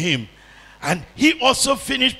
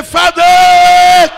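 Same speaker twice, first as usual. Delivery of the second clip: Father yelled much higher and louder; louder call; 11 semitones higher, 14 dB louder than usual. Shown as a demonstration. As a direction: throw Father out with full force. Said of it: "Can you raise it louder and say".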